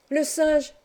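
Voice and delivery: put-on high, bright child's call of surprise; a boy spotting the monkey, crying out high; excited cry. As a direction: call out the monkey with sudden delight. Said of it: "s'écria Arthur".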